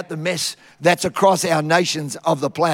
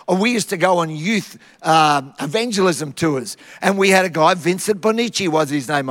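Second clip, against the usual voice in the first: ordinary delivery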